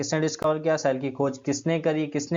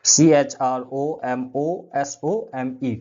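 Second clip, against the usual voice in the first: slow dictation of each letter; spelling out letters; 5 dB louder, much slower than usual. Shown as a demonstration. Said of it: "ये तीन नाम याद रखिएगा". (अक्षर-अक्षर धीरे-धीरे spelling)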